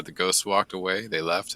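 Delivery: usual speaking voice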